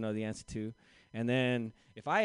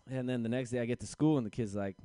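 usual speaking voice

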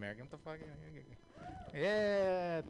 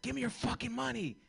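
high, punchy mugger yell; jumping out of bushes at a kid, demanding; mock robber demand; 12 semitones higher, 3 dB louder than usual. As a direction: bark it like a clumsy robber.

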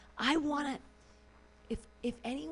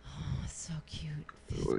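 whispered coo; 5 semitones lower, much slower than usual. Real, not acted. Cooing at teen story.

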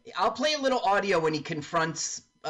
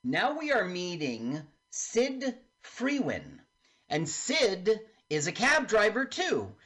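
ordinary delivery

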